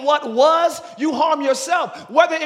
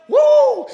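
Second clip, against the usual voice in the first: high woo shout; 11 semitones higher, 8 dB louder than usual. Real, not acted. Preacher's high exclamation.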